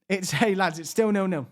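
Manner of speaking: ordinary talk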